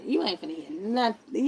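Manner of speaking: usual speaking voice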